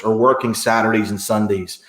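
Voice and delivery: ordinary delivery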